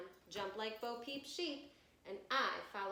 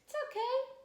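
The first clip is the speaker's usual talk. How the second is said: high reassuring; 8 dB louder, faster than usual. Acted. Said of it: "says Louis".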